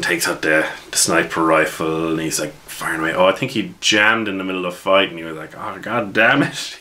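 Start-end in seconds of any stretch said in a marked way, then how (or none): 5.6-6.8 frustrated curse. Retold or imitated. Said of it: "He was so frustrated".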